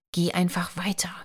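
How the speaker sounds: urgent whisper. Acted. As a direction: whisper it so the cop can't hear. Warning whisper near policeman.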